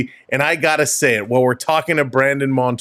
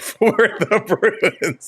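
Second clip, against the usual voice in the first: slow, trying not to laugh; real laughter breaking into the announcement; failing straight face; 4 semitones higher, much slower than usual. Real, not acted. Host starting the Bruins lineup, cracking up. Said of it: "Can't even say it with a straight face".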